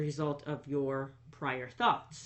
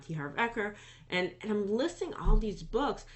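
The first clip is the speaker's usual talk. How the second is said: ordinary talk, nothing marked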